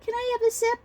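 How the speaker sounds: put-on tiny, very high child voice; high-pitched pleading child; little girl. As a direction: imitate a little kid asking dad sweetly. Imitates little sister asking dad.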